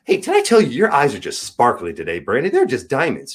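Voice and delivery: high gushing wife, lightly voiced; shower him with fond compliments; sweet wife. Wife soothing Brandy with compliments, lightly voiced gushing. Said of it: "She will stop whatever she's doing and go".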